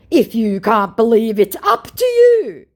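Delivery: loud, high mock indignation; indignant. Scammer defends his fake voice note, indignant. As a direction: huff it out, drawing out the last words. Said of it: "declares my indignant scammer".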